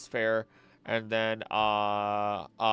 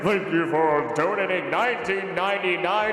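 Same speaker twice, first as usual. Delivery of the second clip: excited; 7 semitones higher, 8 dB louder than usual. Real, not acted. Thanking donor.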